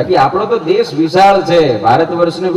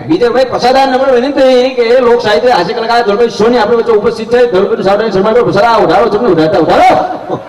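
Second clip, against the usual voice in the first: high-pitched mock ceremony host; hammed-up village MC; village announcer; 6 semitones higher than usual. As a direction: insistently call the guest up. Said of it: "ફાડી નાખે એવી".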